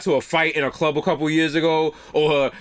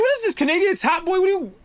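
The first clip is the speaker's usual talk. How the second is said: mock disbelief; 14 semitones higher than usual. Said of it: "I was like".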